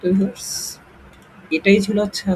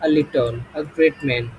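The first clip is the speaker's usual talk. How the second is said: words stretched out slowly; teacher drawing out the examples; slow demo examples; 2 semitones lower, much slower than usual. Shown as a demonstration. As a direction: read it slowly so students follow. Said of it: "যেমন a few".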